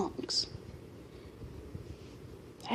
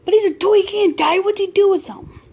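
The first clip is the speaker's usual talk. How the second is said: put-on much higher and louder, a kid's disbelieving panic; shocked outburst; 9 semitones higher, 5 dB louder than usual. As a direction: blurt it out in horrified disbelief. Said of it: "I'm like".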